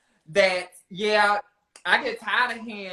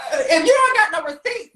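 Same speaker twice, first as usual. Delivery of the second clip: laughing through the words, higher and louder; cracking up while teasing her; giggling as the challenge comes out; 9 semitones higher, 5 dB louder than usual. Real, not acted. Teasing argument, laugh heard.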